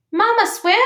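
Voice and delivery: high, loud chick calling out; little gosling voice. Acted. Gosling calls to his mother, high little voice. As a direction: pipe up as an eager baby bird.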